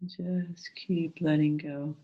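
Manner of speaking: calm, gentle meditation guide. Acted.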